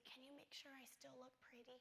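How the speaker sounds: a fragile whispered wish; faint request. Retold or imitated. Dying sister asking to look pretty, barely audible.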